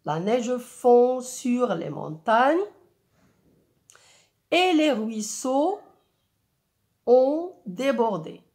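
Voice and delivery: slow, sung-out poem lines; expressive poem reading. Shown as a demonstration. Teacher models expressive reading of the poem for pupils. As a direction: read the verse with rhythm and care. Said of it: "lecture expressive d'un poème".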